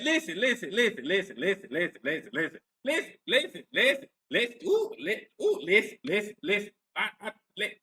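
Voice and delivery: mock broken-record stammer, pitch jumping up and falling away; stuck-in-a-loop mimicry. Host mocks a woman stuck repeating herself, looping stammer. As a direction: imitate someone who can't get past one word. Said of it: "she gets stuck in a loop".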